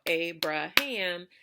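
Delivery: "Abraham" split into three beats; each syllable of "Abraham" said separately. Shown as a demonstration. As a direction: clap out "Abraham", one beat per syllable. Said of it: "if I were clapping that".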